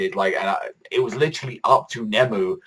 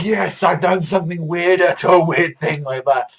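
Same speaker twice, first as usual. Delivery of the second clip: mock Mayuri; 9 semitones higher, 4 dB louder than usual. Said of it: "he's just laughing".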